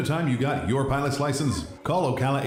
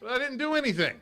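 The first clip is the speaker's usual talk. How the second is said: high, indignant denial; defensive protest; 13 semitones higher than usual. Acted. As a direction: protest your innocence. Spouse defends himself, high.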